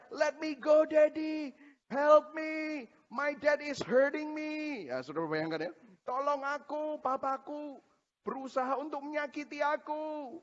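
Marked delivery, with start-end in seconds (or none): 0.1-4.8 put-on high, desperate child begging; pleading toddler. Toddler begging daddy during shots, pleading child. Toddler begs father to release. 6.0-10.4 lightly voiced child, higher and softer; hint of child plea. Pastor translating his son's cry, soft child voice.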